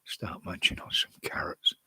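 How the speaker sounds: hushed unvoiced whisper. Real, not acted. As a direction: breathe it as a secret aside. Joking aside about carrots.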